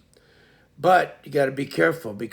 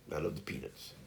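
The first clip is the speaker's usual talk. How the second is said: whispering; 9 semitones lower, 14 dB quieter than usual. Real, not acted. Fond aside about peanuts.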